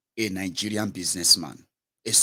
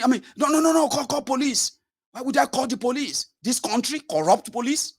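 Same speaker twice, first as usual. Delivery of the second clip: outraged businessman. Retold or imitated. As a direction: rant high-pitched at the reporter. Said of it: "businessman refuses police".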